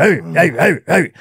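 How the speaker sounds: repeated mock primitive grunting; early-human grunts. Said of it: "pointing and grunting".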